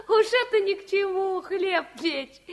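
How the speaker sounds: words spilling through giggles; child still giggling while teasing the student; laughing. Acted. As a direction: keep laughing as you talk. Girl laughing while speaking.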